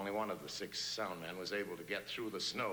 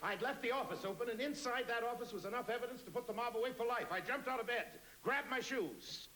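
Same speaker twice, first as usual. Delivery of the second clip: much higher, urgent, breathless private-eye voice; frantic detective narration. Acted. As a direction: race through the action in rising panic. Frantic radio drama climax.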